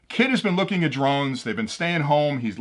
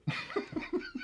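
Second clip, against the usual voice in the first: high squeal of laughter; 16 dB quieter than usual. Real, not acted. Laughs at own joke.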